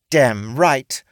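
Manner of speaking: T snapping her agreement; firm orc woman. A narrator's reading.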